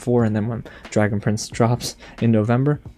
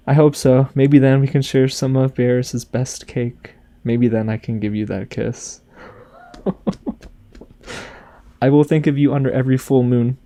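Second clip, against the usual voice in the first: tender reading of a farewell letter, lightly voiced. Reading Rayla's letter to Callum aloud, gentle and tender.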